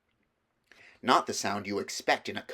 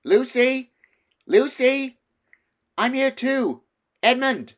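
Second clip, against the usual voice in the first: high, loud shouts through the wood; shouting; 10 dB louder, slower than usual. A narrator's reading. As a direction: call out to find his sister. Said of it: "and so he shouted".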